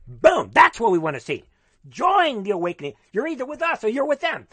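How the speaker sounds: loud, fervent rallying cry, pitched high; real excitement bursting out in a plea; getting excited. Real, not acted. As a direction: get carried away urging everyone to join. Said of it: "I'm getting excited".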